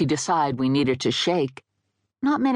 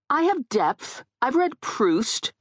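much higher pitch, affronted; indignant protest; 11 semitones higher, slower than usual. Acted. Offended woman defends herself to dead guy, indignant. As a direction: defend yourself with wounded pride. Indignant reply to a perceived insult.

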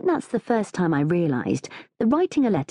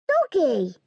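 put-on high toddler voice; little daughter Minnie; 10 semitones higher than usual. A narrator's reading. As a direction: pipe up like an excited small child. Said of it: "says Minnie".

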